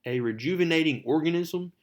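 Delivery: high, mock-astonished questions; incredulous questioning. Peter reacting to Connors's confession, incredulous. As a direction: echo it back in disbelief.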